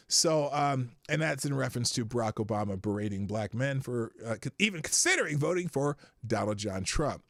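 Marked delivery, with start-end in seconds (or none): none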